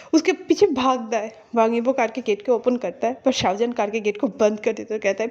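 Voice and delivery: plain reading voice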